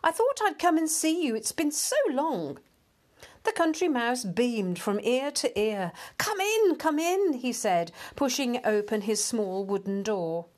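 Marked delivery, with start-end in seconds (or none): 0.0-2.6 high, eager town mouse; excited greeting. A narrator's reading. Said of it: "he cried". 6.2-7.3 high, eager invitation; delighted welcome. A narrator's reading. Delighted mouse welcomes his friend in.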